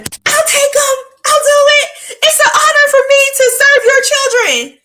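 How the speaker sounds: hammed-up eager helper begging to serve; eager people-pleaser. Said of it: "I was the send me, I'll go person".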